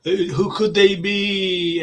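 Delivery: high, slow, mystical medium voice drawing out each word; mock psychic medium. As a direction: imitate a TV psychic fishing for names, high and slow. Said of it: "they just put on them voices".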